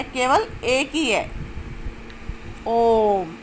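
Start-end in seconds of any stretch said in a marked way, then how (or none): 2.7-3.4 ओम held for three beats; sustained, chant-like ओम; prolonged vowel. Shown as a demonstration. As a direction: draw out the o of ओम at length. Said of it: "तीन मात्राओं का समय लगता है".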